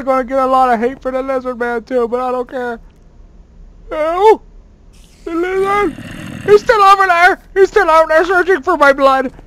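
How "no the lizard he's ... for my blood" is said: mock terror. Renewed lizard panic.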